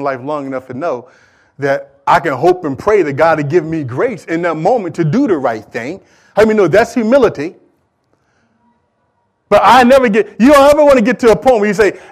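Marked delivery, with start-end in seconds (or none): none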